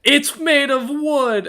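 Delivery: he snaps back about his wooden foot; indignant retort. Acted. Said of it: "Czar complaining".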